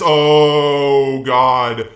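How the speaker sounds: ordinary talk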